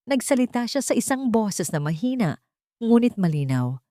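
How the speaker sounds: usual speaking voice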